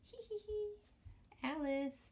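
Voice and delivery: high giggly little creature voice; lightly voiced giggly character. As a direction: giggle it out cutely. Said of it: "And it says".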